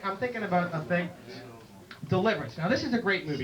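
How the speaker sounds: ordinary talk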